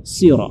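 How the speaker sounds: "sira" with a heavy S, nearly "siro"; emphatic S in "sira"; thick sod. Shown as a demonstration. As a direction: say "sira" dark and rounded. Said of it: "sira dibaca tebal".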